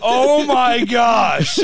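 slow, loud "oh my gosh" through laughter; drawn-out laughing alarm; panicked exclaim. Retold or imitated. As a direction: exclaim it like you just saw the open kennel. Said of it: "and I'm all".

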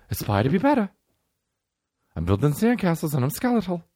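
lightly voiced celebrity slogan; a hint of a pumped-up star; Mark Wahlberg impression. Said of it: "Hey, Mark Wahlberg".

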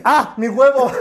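high, loud mock cry of alarm; blurted out loud. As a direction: yelp it as if it just slipped out. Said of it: "en voz alta dije". (grito de alarma, en voz alta)

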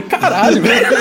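laughing retold dismay; retold exasperation. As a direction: curse in mock disbelief, half laughing. Retold exasperation at being called again.

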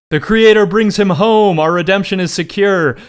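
loud, high rallying cry, acted out; high-pitched mock battle shout; imitated yelling. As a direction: mimic warriors yelling in triumph. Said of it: "they start yelling".

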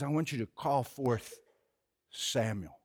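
low, soft, secretive ask; a disguised king quietly asking for Samuel; hushed request. Retold or imitated. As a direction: lean in and murmur the request.

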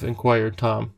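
plain reading voice

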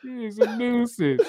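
high-pitched, cracking up through the words; helpless laughter in the voice; laughing. Real, not acted. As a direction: squeal it out while laughing. Laughing after his screaming bit.

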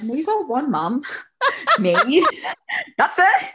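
mock-stern refusal breaking into high laughter; giggling while laying down the law; laughing through it. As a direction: play it tough but crack up laughing. Mock refusal of two mums, laughing.

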